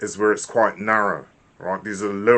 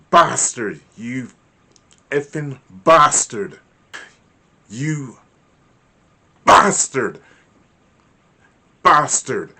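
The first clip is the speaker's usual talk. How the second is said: louder, slow, biting curse; angry cursing; 3 semitones higher, 6 dB louder, slower than usual. Shown as a demonstration. Teacher demos the word bastard angrily. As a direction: hurl each bastard with anger. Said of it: "Can you hear the different frequency".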